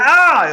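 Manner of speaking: loud, high retold cry of pain; sharp loud ouch cry; pained yelp. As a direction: yelp as if jabbed again. He yelps at the toe.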